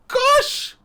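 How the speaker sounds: a squeal of surprise, very high; a sudden, very high gasp of delight; surprised. Real, not acted. Reacting to gift subs on stream.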